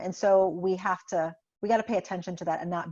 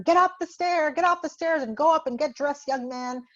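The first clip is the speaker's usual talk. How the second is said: high, loud scolding, fading at the end; mock yell; 8 semitones higher, 3 dB louder than usual. Parent yelling at son to get dressed, loud scolding. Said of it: "we start yelling at them".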